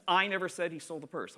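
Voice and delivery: emphasize I as the contrasted word; stress on I. Shown as a demonstration. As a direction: punch the opening I, let the rest fall away. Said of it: "they have six different meanings".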